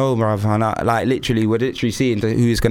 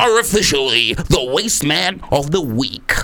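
announcer voice. Acted. Announcing the winner on air.

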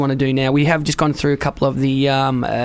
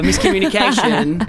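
ordinary talk

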